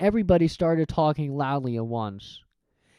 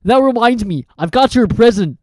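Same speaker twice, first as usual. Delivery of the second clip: louder, sniffly giant; Hagrid's voice; 7 semitones higher, 8 dB louder than usual. Acted.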